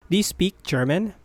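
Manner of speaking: ordinary talk, nothing marked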